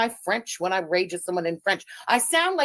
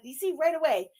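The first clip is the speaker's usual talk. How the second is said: normal talking voice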